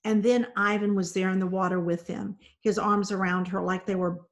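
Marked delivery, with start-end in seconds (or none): none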